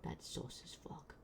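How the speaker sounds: a sly muttered aside; whispered aside. Real, not acted. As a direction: whisper That's sauce as fuck under the breath.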